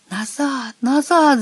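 high-pitched urgent warning; stretched, high call to turn back; alarmed cry. A narrator's reading. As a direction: cry out in alarm without full volume. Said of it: "закричал он".

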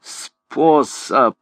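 an understated, exasperated demand; furious cry. A narrator's reading. Claude demands the plan from Gringoire, understated fury. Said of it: "Вне себя от ярости крикнул Клод".

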